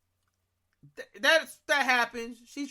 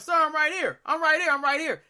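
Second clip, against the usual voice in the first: screaming into the phone; 7 semitones higher than usual. Retold or imitated. Caller yells to the 911 operator who can't hear. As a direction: insist you are right there. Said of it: "I'm screaming in the phone".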